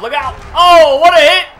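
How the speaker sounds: very high, very loud hype call; excited yelling. Real, not acted. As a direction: scream the hit like a broadcaster. Calling a huge hit live.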